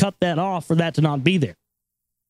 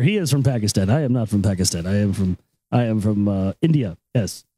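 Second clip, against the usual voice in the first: low-pitched put-on Indian accent; mock Indian accent, low and earnest; Indian store clerk; 9 semitones lower than usual. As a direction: imitate an Indian clerk clarifying, voice dropped. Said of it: "stand behind the counter".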